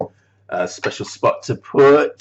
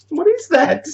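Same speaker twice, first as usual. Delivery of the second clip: squeaky bafflement. Real, not acted. Baffled at a found object.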